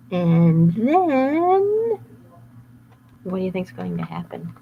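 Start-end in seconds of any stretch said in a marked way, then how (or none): none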